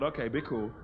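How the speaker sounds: low, soft self-calming; calming inner voice. Retold or imitated. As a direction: talk himself down quietly. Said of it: "I thought".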